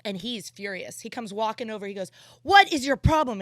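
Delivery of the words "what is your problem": mock angry cop yell; retold yell. Undercover cop confronting driver, mock yell. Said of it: "And he's yelling at me".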